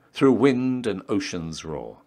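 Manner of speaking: ordinary talk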